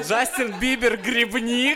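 high, loud, slow headline shout; tabloid headline voice. Shown as a demonstration. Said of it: "Жёлтый заголовок просто".

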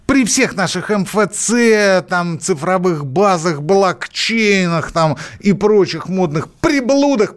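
slow, high scornful listing. Real, not acted. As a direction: drag out each item mockingly. Mocking list of modern tech.

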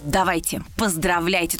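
ordinary delivery

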